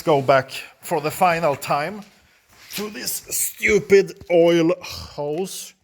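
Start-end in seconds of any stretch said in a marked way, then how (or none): none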